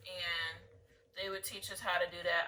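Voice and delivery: usual speaking voice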